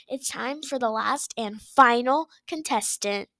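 usual speaking voice

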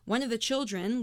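ordinary talk, nothing marked

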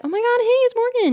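hammed-up thrilled hello; re-enacted giddy greeting. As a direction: gush it way up high. Her thrilled reply to his text.

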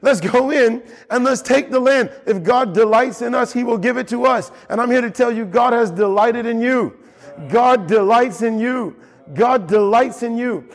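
high, impassioned preacher voice; preaching. Acted. Pastor rallying the church with fervour. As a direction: preach it out to the congregation.

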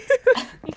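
high loud laughing shriek; loud shriek of laughter. Real, not acted. Burst of laughter.

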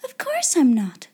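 put-on emphatic girlish denial; mild exclamation of reassurance; crying out. A narrator's reading. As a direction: reassure her firmly. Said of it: "cried out Sarah".

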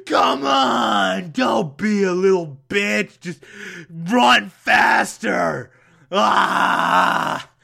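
hammed-up teacher pushing a slow runner; yelling gym teacher. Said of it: "some asshole gym teacher is yelling at him like".

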